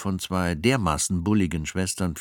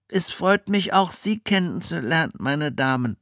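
put-on boy voice; 10 semitones higher than usual. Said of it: "log er ein zweites Mal".

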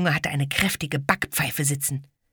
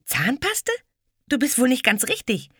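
put-on indignant girl; 10 semitones higher than usual.

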